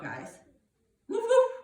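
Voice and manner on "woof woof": sharp, high, loud woofs; dog bark. Shown as a demonstration. Reader cueing kids' down dog, loud barking. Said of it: "Do your down dog".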